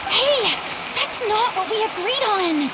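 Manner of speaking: indignant protest. Real, not acted. Surprised protest at public thanks.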